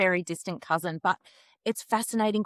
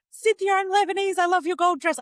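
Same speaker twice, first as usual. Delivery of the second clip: high, bright gushing compliment, much louder; gushing excited; 12 semitones higher, 3 dB louder than usual. Pretend. Proud aunt-teaser gushing loudly over gold dress. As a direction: gush over her heritage, high and loud. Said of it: "I'm like".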